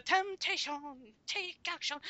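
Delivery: high mock rock-singer belt of the lyric; imitating Shu. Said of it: "when Shu busts in with the".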